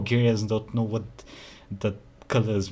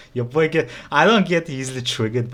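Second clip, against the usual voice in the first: higher, louder defensive outburst; a little triggered; 5 semitones higher, 5 dB louder than usual. Real, not acted. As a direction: flare up a little in protest. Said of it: "get your boy a little triggered".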